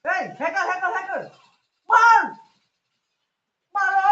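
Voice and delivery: high, loud frantic yelling; excited shout. Real, not acted. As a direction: scream it out in panic. Spotting a hacker mid-fight.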